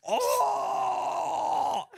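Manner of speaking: high, loud mock yelp; mock scream. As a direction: shriek it like the guy in the clip. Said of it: "a Chinese guy screaming".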